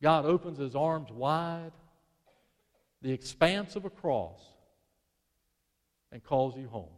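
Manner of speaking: normal talking voice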